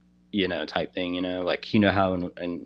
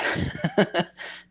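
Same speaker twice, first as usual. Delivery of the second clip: high, breathy laugh; amused laugh; 12 semitones higher than usual. Real, not acted. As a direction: laugh breaking into your own sentence. Laughs while telling of the smiling thing.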